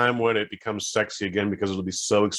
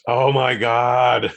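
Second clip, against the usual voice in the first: swooning; 3 semitones higher, 5 dB louder, much slower than usual. Pretend. Swooning over a smoker.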